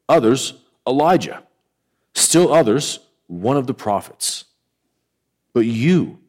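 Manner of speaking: measured, solemn Bible reading with long pauses; deliberate pulpit reading, phrase by phrase; scripture reading. Acted. Reads the Bible passage aloud.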